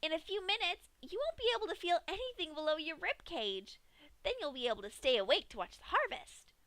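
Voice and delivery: high, cheery and chilling; Pinkie's voice. Acted. Pinkie leans down to tell Dash, high cheery voice. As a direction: explain the horror like good news. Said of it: "Pinkie leaned down and elaborated".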